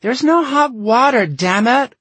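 high, loud outraged yell; cursing. Acted. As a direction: shriek it in fury from another room. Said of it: "heard Elizabeth cursing".

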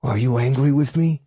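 a worried, eager dog asking; big dog voice. A narrator's reading. Oberon asks timidly.

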